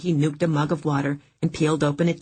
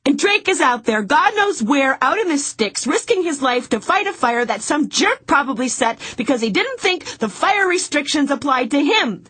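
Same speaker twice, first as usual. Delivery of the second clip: high, louder, worried rush; anxious, upset; 11 semitones higher, 4 dB louder than usual. Acted. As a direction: fret about him, voice strained. Continued tearful worry.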